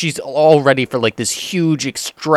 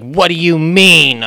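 indignant customer; 6 semitones higher, 7 dB louder, slower than usual. Retold or imitated. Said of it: "And she's like".

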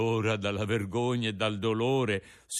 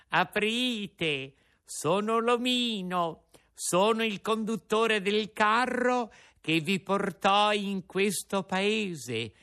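menacing little man; 7 semitones higher, slower than usual. Acted. Menacing coachman at the door.

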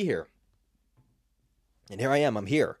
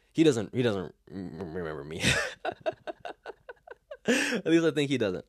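laughing; 3 semitones higher, 6 dB louder than usual. Real, not acted. Laughs mid-story about nephew.

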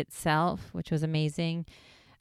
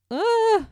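startled ugh; 8 dB louder than usual. Retold or imitated. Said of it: "So it's like".

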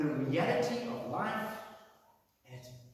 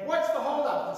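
high, loud impatient call; man at the back calling ahead, impatiently; 9 semitones higher, 7 dB louder than usual. Retold or imitated. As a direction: shout forward to see what's stopping them. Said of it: "someone at the back of Jesus crowd going".

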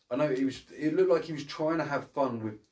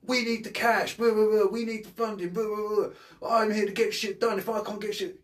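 much higher, louder ranting cop parody; mock renegade cop; 9 semitones higher, 4 dB louder than usual. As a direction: ham it up like a cliché cop yelling at his boss. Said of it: "his boss comes over and he goes".

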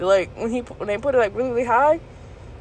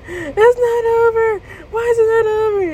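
mocking repeat. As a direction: parody a panicked squeal. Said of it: "doing a repeat of things I said".

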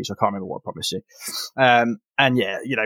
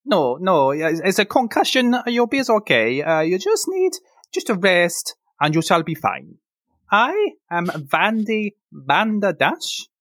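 higher, fussy healer voice; Vandy the priestess; 6 semitones higher than usual. Acted. Priestess to the concussed hero, higher fussy voice. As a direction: reassure the patient kindly.

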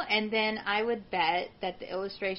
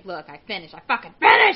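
fed-up exclaim; 3 semitones lower, 11 dB louder than usual. Retold or imitated. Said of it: "and say".

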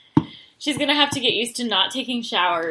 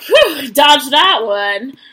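relieved; 3 semitones higher, 9 dB louder, much slower than usual. Retold or imitated. Connor relieved, imitated.

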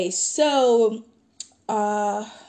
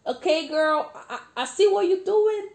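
high, loud, flirty teasing; playful teasing; 7 semitones higher, 5 dB louder than usual. Real, not acted. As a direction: tease her like a flirt. Teasing flirt toward friend.

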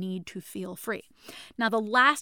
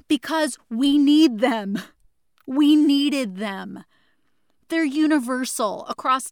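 much louder, slow, impassioned; loving shout; 3 semitones higher, 8 dB louder, slower than usual. Real, not acted. Host passionately telling listeners these needs are real, slow loud shout. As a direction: cry it out with love. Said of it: "I'm screaming at you with love".

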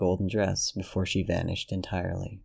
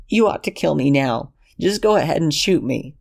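put-on high, pleading young man's voice; higher and louder, pleading with Ren; desperate Lexington; 7 semitones higher, 5 dB louder than usual. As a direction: beg him to understand, voice pitched up. Lexington begging for death.

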